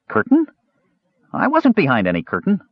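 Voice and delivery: high, piping denial; puzzled kender. A narrator's reading. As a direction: protest in a high little voice.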